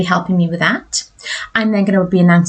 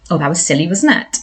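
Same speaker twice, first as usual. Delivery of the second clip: ordinary delivery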